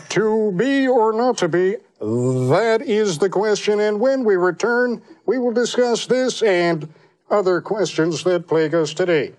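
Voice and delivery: solemn anchor declaiming Shakespeare; Ted Koppel as Hamlet, high and slow; Koppel doing Shakespeare. Retold or imitated. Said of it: "Imagine him doing Shakespeare".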